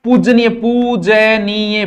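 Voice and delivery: पूजनीय slowly, repeated in long separate syllables. Shown as a demonstration. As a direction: spell out पूजनीय by sound, stretching poo-ja-nee-ya. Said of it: "पूजनीय ऐसे लिखा जाएगा".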